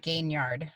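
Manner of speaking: Gainyard said as "gain" plus "yard"; surname pronunciation. Shown as a demonstration. Artist teaching the host her surname, Gainyard pronounced gain-yard. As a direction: say Gainyard like gaining yardage, cut short. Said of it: "I pronounce it".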